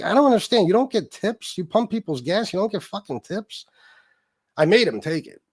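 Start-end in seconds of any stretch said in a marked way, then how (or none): 0.0-3.6 retold incredulous questioning, pitched high; baffled protest. Teller replays questioning a gas attendant who refused his tip, baffled. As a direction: act out being baffled, voice pushed up, easing off in volume.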